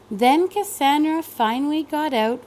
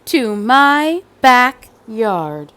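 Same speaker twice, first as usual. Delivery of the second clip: put-on slow emphatic child; 6 dB louder, much slower than usual. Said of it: "said Cassandra".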